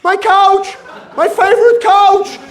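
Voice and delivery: high, loud wailing about the couch; mock scream. Rescued woman screaming for her couch, high and loud. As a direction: shriek like a hysterical homeowner. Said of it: "she screams".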